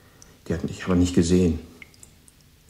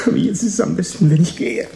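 much higher, louder, voice pitching up in panic; panicky; 11 semitones higher, 7 dB louder than usual. Acted. The boy tells Tom he must leave, rising panic. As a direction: blurt it out, scared and wound up. Boy confesses, decides to flee.

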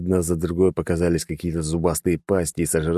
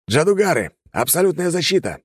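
shouting; 11 semitones higher than usual. A narrator's reading. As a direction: call the command out hard. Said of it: "крикнул Арамашир".

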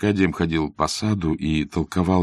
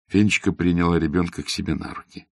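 plain reading voice

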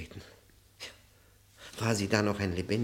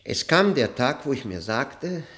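erregt laut; 3 semitones higher, 7 dB louder than usual. Acted. Erregter Monolog des Professors.

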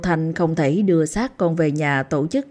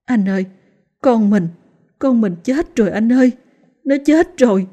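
high, louder grief-stricken cry; acted wail of a bereaved mother; tearful outburst; 6 semitones higher, 4 dB louder than usual. A narrator's reading. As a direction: break down to your husband on the phone. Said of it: "cô òa lên khóc nức nở".

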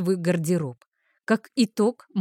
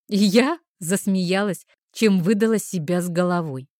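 ordinary delivery

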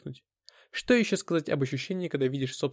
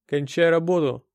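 raised, louder order from a bull of a man; gruff order; 3 semitones higher, 6 dB louder than usual. Acted. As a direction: order the worker to finish. Bully gives orders in story. (грубый приказ)

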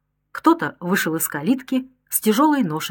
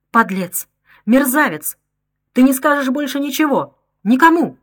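furious outburst; 6 semitones higher, 5 dB louder than usual. A narrator's reading. Woman shoots her blackmailing husband.

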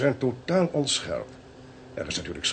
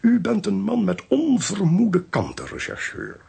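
verrast bewonderend; 8 semitones higher, 4 dB louder than usual. Acted. Prijst de rechercheur verrast na ontdekking.

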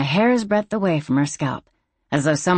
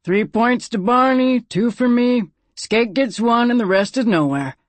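calling out; 6 semitones higher than usual. A narrator's reading. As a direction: sing out the score to the crowd. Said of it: "He called out as he did so".

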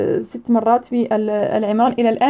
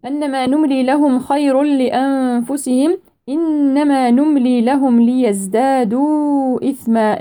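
tajweed recitation; Quran recitation; 3 semitones higher, slower than usual. Shown as a demonstration. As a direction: draw the verse out melodically. Slow recitation of verse.

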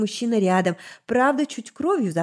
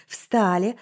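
ordinary delivery

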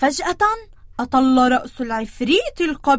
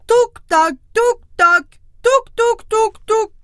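loud, fast hammering knocks; very hard hoof strikes; 8 semitones higher, 6 dB louder, faster than usual. Acted. Billy goat pounding the bridge, loud hoof knocks. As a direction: pound the hoofbeats hard and quick. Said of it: "بقوة شديدة جدًا".

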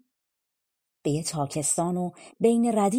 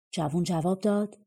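plain reading voice

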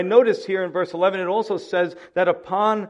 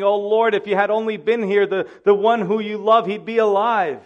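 higher, louder, pleading lament; grieving plea; 5 semitones higher, 5 dB louder than usual. Retold or imitated. Grieving sisters plead with Jesus at the tomb, high and pleading. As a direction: beg him with grief in the voice.

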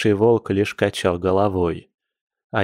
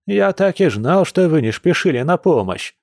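higher, mildly angry grumble; злое ворчание; 7 semitones higher than usual. A narrator's reading. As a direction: grumble an accusation in a raised pitch. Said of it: "зло буркнул Яр". (сердитое ворчание повыше)

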